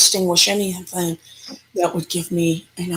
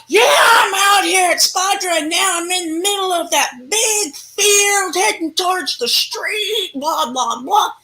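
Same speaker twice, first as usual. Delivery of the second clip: fake phone call; 12 semitones higher than usual. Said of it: "acting like I'm talking to somebody".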